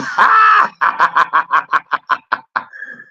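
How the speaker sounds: big burst of laughter, almost a shout; loud laugh. Real, not acted. Streamer to chat mocking the BBC, bursting out laughing.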